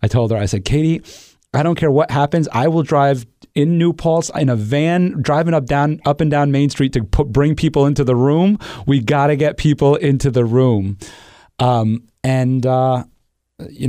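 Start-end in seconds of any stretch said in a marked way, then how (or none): 0.6-11.0 higher-pitched, insistent vow; retold rallying plea, pitch lifted throughout; determined urgency. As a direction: insist with mounting resolve. Retelling vow to fill the room.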